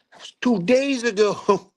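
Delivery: very high, drawn-out exasperation; retold outrage. Commander learning of lieutenant's delay, outraged. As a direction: re-enact disbelief, stretching words high.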